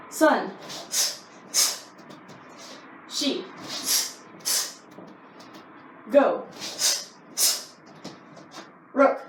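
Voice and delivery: barked Japanese count, one per strike; sharp martial arts commands. Shown as a demonstration. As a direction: call each count crisply like a sensei. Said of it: "You're gonna do ten more".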